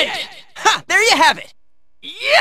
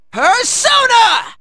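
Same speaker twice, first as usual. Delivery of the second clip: slow, high-rising battle call; summoning shout; 4 semitones higher, slower than usual. Acted. Hero summoning in battle, drawn-out high shout. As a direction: draw it out into a shout.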